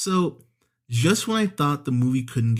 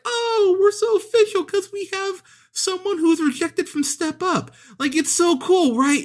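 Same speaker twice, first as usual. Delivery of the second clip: much higher, sneering mock voice; very high mocking impression; mocking dancers. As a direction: mimic them as snooty show-offs.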